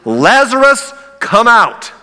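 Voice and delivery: high, loud call into the tomb; shouting. Acted. Jesus calling the dead man from the grave, loud shout. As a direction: call him out with full force. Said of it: "Jesus shouted".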